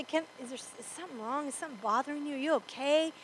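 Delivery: soft, caring check-in; a quiet worried friend; gentle concern. Retold or imitated. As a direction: ask softly like you truly care. Preacher voices a merciful response.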